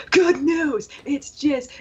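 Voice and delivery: high excited yell. Retold or imitated. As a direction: shout it out, high and bright. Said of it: "yelling at them".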